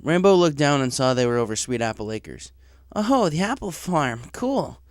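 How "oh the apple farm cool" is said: put-on higher, eager young pony voice; nervous, keen to impress the boss; young tomboy pony. Job applicant to the director, eager young voice. Rainbow sees farm.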